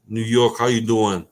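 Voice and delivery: quick sketch of a New York accent. Shown as a demonstration. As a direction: toss out a New York street greeting. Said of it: "That was a New York accent".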